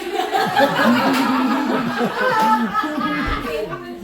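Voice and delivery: long real laugh, building up; loud rising laugh. Real, not acted. Comic laughing hard at own joke. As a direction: burst out laughing.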